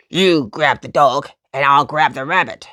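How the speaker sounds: low, loud menacing fox; a villain barking orders; biggest fox. A narrator's reading. Fox ordering his partner to grab the rabbit, low and menacing. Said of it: "called the biggest fox".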